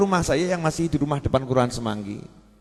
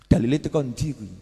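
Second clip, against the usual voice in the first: mock skeptic; 2 semitones lower, 4 dB quieter than usual. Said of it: "yang nonton di T V ini loh".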